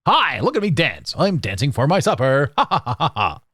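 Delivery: mock performing clown with forced laugh; put-on showman, fake ha-ha laugh; entertainer character. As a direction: ham it up, dancing for applause. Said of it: "But I'm also entertaining".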